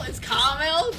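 "caramel" as CAR-mel, high and loud; loud, contesting CAR-mel for "caramel"; two-syllable caramel. Shown as a demonstration. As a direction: insist on "caramel" with the middle syllable dropped. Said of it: "That's how it's spelled".

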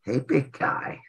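low, soft joking greeting; weird greeting. Shown as a demonstration. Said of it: "I'm not saying it like that".

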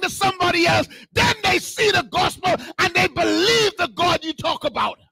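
high-pitched, impassioned sermon cry; full-force preaching. Real, not acted. Preacher urging church to bless somebody else, impassioned preaching. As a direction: preach it out with fervour.

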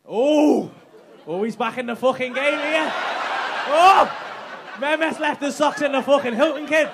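loud, laughing, gleeful jeering; excited teasing shout. Real, not acted. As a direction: crow over it loud and laughing. Gleeful teasing at Hilton reveal.

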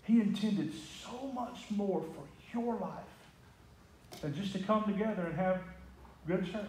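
hushed, deliberate emphasis; slow soft repeat. Shown as a demonstration.

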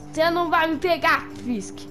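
provocação aguda e rápida; taunting. Acted. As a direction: zombe enquanto foge. Herói provoca enquanto foge. (provocando animado)

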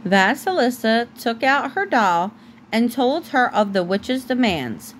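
ordinary delivery

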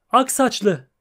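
high, loud call across the water; mermaid shouting. Acted. Mermaid hailing the witcher from the sea, high loud shout. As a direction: shout out a greeting from the waves. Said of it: "diye bağırdı".

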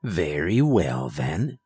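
slow, low cold concession; cold villain. A narrator's reading. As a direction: say it coldly, dropping low. Charles coldly concedes.

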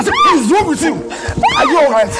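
high, loud yelling; husband shouting at his wife; angry yell. Acted. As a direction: bark the question at her.

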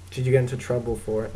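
normal talking voice

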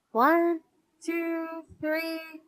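high, level-pitched counting; measured storybook tally, held up high; counting aloud. A narrator's reading. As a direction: count item by item with pauses between. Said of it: "Muffy walked down the line counting".

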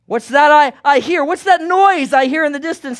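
high, loud puzzled question; curious alarm. Acted. A townsman hears the apes coming, high and loud. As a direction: strain to hear a strange noise. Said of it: "Someone says".

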